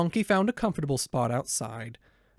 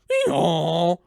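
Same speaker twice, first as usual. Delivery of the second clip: loud braying hee-haw; a loud, rough donkey hee-haw; 3 semitones higher, 6 dB louder than usual. Acted. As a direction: bray like a donkey kicking out. Donkey kicks the robber.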